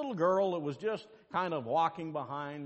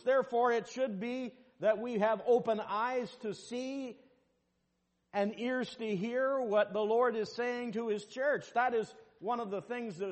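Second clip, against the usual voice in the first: preaching; 9 semitones higher than usual. Acted. Pastor preaching to the congregation, rising in force. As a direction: build the line up to the pulpit.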